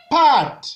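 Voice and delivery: "Pat" starting high and loud, gliding down; falling tone. Shown as a demonstration. Teacher shows the descendant tone, falling "Pat". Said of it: "Ton descendant".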